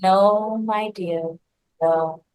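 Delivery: gently and calmly turn them down. Acted. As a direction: serene hen saying no. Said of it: "hen very calmly shook her head".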